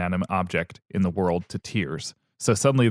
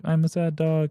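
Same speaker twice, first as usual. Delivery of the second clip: sad dog; 9 semitones higher than usual. Retold or imitated. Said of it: "is like".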